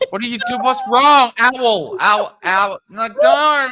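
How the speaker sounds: high, loud words through laughter; laughing hysterically. Real, not acted. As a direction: shriek it out mid laugh-fit. Laughing fit over owls.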